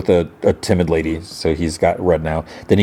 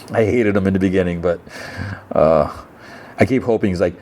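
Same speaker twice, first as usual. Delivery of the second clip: ordinary delivery, nothing marked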